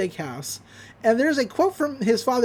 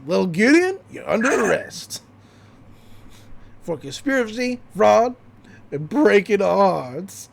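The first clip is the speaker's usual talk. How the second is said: sheriff voice. Retold or imitated. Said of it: "Sheriff Blub says".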